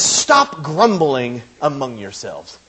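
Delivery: slow, forceful order to stop; emphatic command. Retold or imitated. Preacher quoting Jesus to the grumblers, emphatic. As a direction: STOP drawn out, then each word slow. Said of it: "It is emphatic".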